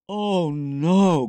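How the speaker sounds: long, dismayed moan of protest; dismayed groan. A narrator's reading. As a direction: groan in dread of a chore. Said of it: "groaned Nick".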